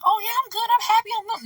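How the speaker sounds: high-pitched put-on cheeriness; fake cheerful. Refusing to fake being happy, mock-cheerful voice. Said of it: "fake the funk and be like".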